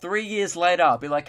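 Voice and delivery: ordinary delivery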